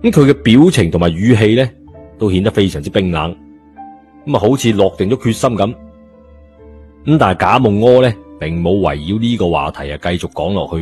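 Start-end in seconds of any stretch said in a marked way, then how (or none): none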